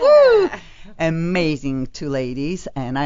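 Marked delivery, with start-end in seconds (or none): 0.0-0.5 loud, high whoop of joy; excited woo. Real, not acted. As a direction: cheer it out big for the guests. Host cheering her guests on air.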